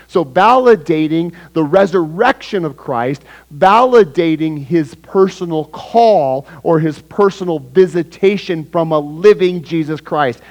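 fervent pulpit emphasis; preaching. Acted. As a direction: press each phrase on the church. Pastor preaching to the church.

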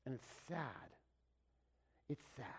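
soft, hushed, saddened; sorrowful hush; quiet sorrow. Real, not acted. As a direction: let the sadness sink, almost whispered. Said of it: "grieving aside".

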